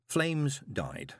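usual speaking voice

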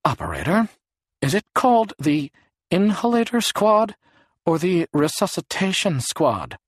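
high, strained and drawn out; weird high-pitched voice. A narrator's reading. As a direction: ask it in an odd high voice, pitch way up. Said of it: "in a weird, high-pitched, slow voice".

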